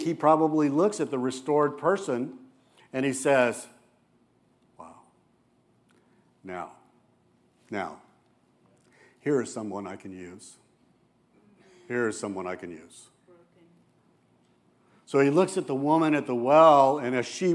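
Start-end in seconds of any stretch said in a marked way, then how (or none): 4.8-13.1 soft, low, marveling voice; tender wonder. Retold or imitated. Jesus gazing at a restored person, soft and awed. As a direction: breathe it out in quiet awe.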